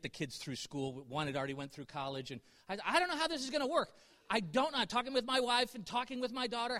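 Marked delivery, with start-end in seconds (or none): 2.9-4.8 higher, quick, mildly flustered retelling; retold worry. Preacher recalls running out of money for school, mildly flustered. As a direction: voice a touch of remembered worry.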